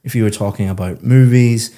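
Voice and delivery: ordinary talk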